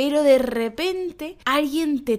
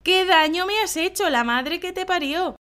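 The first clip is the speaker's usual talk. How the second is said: yelping in mock pain after a stepped-on foot; pain outburst; 6 semitones higher than usual. Someone stepped on your bare foot, mock pained yelp. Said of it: "alguien nos pisa".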